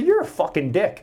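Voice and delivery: high, loud retold insult, hammed up a little; angry insult. Off-duty cop insults the neighbor over his dogs, loud and angry. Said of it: "That's what I said".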